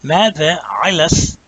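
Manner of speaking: slow, careful Mada Alas with the lam carried over; drawn out Arabic Mada Alas; Arabic pronunciation. Shown as a demonstration. Teacher asks students if they hear it, slow demo. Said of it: "you hear that?".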